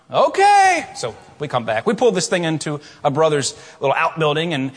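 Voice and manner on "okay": put-on a high, loud childlike okay; young son answering dad, squeaky high okay; son's okay. Son reassured by his dad, a loud high okay.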